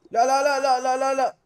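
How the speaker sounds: loud, high rapid-fire alarm; real gamer panic, pitched high; panicked shouting. Real, not acted. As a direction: cry out as it all goes wrong. Panics as game move fails.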